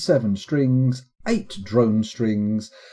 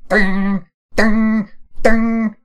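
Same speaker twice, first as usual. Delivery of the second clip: high, loud vibrating "ding"; piercing string plucks; 10 semitones higher, 6 dB louder, much slower than usual. Shown as a demonstration. Streamer trying vibrating and piercing harp notes, high loud dings. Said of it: "vibrating and piercing".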